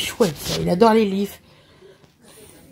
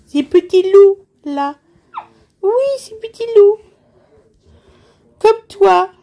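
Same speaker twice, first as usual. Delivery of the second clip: put-on high, louder singsong to a baby; mother cooing to her baby over his first book; baby talk; 8 semitones higher, 4 dB louder than usual.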